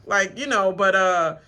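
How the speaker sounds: ordinary delivery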